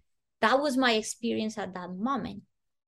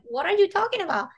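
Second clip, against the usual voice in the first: high, fast baffled question; baffled child; 9 semitones higher, 3 dB louder, faster than usual. Retold or imitated. Child baffled by what adults don't get, high and fast. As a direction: toss it off in disbelief.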